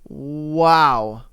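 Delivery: ordinary delivery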